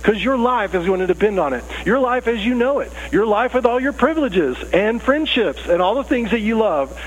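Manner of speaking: high, emphatic lecture from a parent. Acted. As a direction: hammer home the stakes to the kids. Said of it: "I will stop emphasizing the point".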